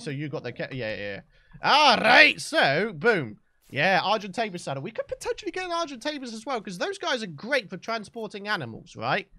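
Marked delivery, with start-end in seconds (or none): none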